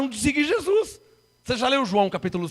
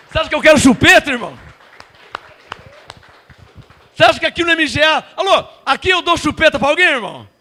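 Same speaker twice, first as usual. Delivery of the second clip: indignant challenge; 4 semitones higher, 6 dB louder, faster than usual. Real, not acted. Indignant fast rhetorical questions.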